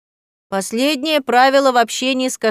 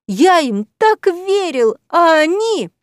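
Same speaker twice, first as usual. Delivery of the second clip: high, loud, slow lament; theatrical lament; 7 semitones higher, 5 dB louder, slower than usual. A narrator's reading. Cat professor lamenting betrayal, high and loud. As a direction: wail betrayal in melodrama. Cat's outcry continues.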